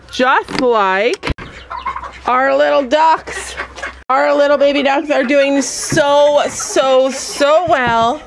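high sing-song voice for the ducklings. Real, not acted. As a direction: coo it like talking to babies. Cooing at baby ducks.

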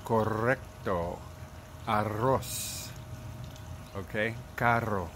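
correcto, arroz and carro with a trilled R; slow careful Spanish trill; rolled R. Shown as a demonstration. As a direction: roll the R in each word. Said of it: "you'll end up with".

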